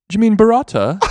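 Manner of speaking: put-on high, querying British accent; imitated British man. British guy correcting her word, high imitated British accent. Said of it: "one of the British guys goes".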